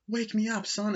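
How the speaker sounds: squeaky mock excitement; high hammed-up quote. Reviewer quoting a funny line from the movie, high and hammed-up. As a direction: leap way up high and play it big.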